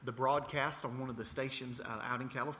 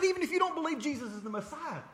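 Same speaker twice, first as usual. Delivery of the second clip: retold indignation; 5 dB louder, faster than usual. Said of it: "I got so mad".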